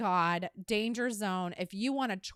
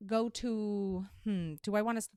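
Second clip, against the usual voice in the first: normal talking voice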